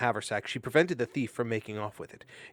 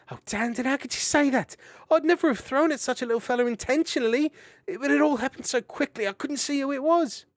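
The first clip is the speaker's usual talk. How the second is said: tearful; 7 dB louder than usual. Acted. Mariel near tears.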